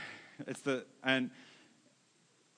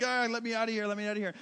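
higher-pitched mock wail of protest; imitated crying kid; 5 semitones higher, much faster than usual. Kid being strapped into a roller coaster, mock crying. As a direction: imitate a kid begging to get out. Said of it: "and they're crying".

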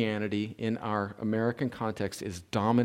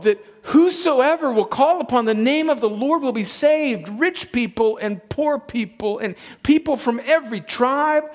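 high, loud preacher's proclamation; impassioned preaching; 11 semitones higher, 6 dB louder than usual. Real, not acted. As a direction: proclaim it with rising fervor. Preaches at full force.

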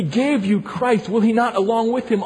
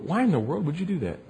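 softer, coaxing and fast; wooing; 8 dB quieter, faster than usual. Shown as a demonstration. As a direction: coax them gently, quickly.

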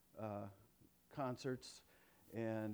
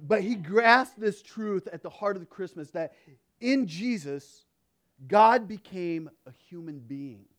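emphatic preaching; 4 semitones higher, 12 dB louder than usual. Acted. Emphatic sermon point.

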